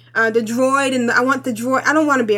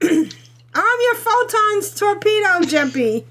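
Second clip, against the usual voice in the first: mock English accent; 7 semitones higher, slower than usual. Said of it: "that an English accent?".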